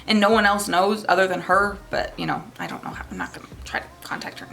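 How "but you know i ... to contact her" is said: breathy whisper; whispered aside. Real, not acted. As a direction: drop to a whisper. Aside, lowered voice.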